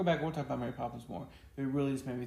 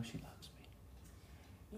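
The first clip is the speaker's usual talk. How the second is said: slow, whispered fond aside; soft aside; 17 dB quieter, much slower than usual. Real, not acted. Husband whispering about wife's love, slow and fond. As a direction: murmur it slowly to yourself.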